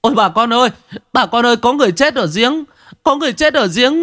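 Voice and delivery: high-pitched frantic cry for help; screaming alarm. A narrator's reading. Young villager alerts neighbours to a dead man, screaming. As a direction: call out urgently to the whole village, very high. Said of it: "chạy về làng gào thét".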